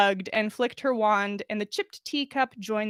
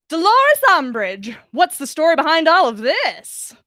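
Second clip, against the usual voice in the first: loud, higher gossip call; eager reporter call; 6 semitones higher, 8 dB louder than usual. Acted. Rita Skeeter bursting in, loudly eager for a story.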